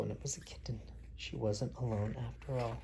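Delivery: hushed storybook whisper. A narrator's reading. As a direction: drop to a secret whisper. Narrator hushes for the kitten.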